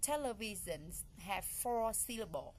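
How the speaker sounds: ordinary talk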